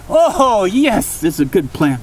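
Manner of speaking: high delighted "oh, yes" easing down; gleeful scheming. Acted. Matthew pleased with his poison plot, gleeful. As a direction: savor the wicked plan.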